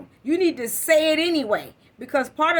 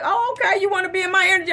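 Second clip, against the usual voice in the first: sarcastic challenge; 6 semitones higher, 4 dB louder, much faster than usual. Retold or imitated. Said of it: "I was like".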